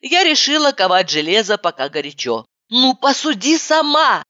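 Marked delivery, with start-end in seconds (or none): 2.7-4.2 shouting. Acted. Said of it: "закричала я".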